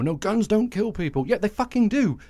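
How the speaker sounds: scornful and heated; exasperated rebuttal. Real, not acted. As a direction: throw their slogan back with rising anger. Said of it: "rebuttal of quoted argument".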